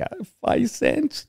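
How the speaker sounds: high, soft, hesitant answer; tiny voice, drawn out and tentative; timid child. Retold or imitated. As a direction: imitate a small unsure kid, slowly. Acted child unsure of the price.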